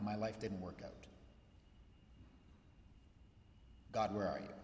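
low, hushed lament to God; quiet despair. Retold or imitated. Alone in the garage, a hushed despairing plea to God. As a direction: let it sink, near defeat.

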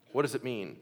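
normal talking voice

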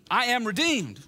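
high, loud proclamation; rousing declaration. Shown as a demonstration. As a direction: declare it boldly to rally the congregation. Rallying congregation to declare it aloud.

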